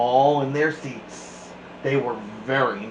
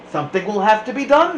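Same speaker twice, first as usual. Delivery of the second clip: higher, louder, fast conspiratorial push; urgent plotting; 7 semitones higher, 6 dB louder, much faster than usual. Acted. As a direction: rush it out like schemers. Ringleaders plotting in schoolyard.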